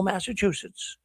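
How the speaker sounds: ordinary talk, nothing marked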